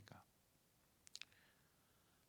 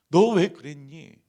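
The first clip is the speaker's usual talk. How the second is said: loud, slow, higher reproach; rebuking shout; 6 semitones higher, 23 dB louder, slower than usual. Pretend. Jesus imagined rebuking Peter, loud slow rebuke. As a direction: call it out as a stern rebuke.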